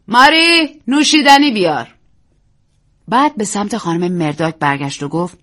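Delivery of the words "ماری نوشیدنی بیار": loud, higher call; shouting. Acted. Hostess shouts to maid Mary for a drink. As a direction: shout down the hallway. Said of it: "رو به راهرو فریاد زد".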